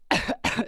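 colpo di tosse strozzato; choking cough. Real, not acted. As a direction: tossire come se si fosse strozzata. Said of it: "mi sto strozzando". (tosse soffocata)

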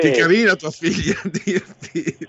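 amused teasing, laughing through; laughing teasingly. Real, not acted. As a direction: tease with a laugh.

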